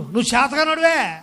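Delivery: usual speaking voice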